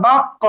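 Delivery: "বাক্য" with a bounced, echoing q; bouncing qalqalah. Shown as a demonstration. Teacher shows learners how to sound qaf, bouncing qalqalah. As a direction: push the stopped q in "বাক্য" into a short echo. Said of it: "এরকম উচ্চারণ করতে হবে".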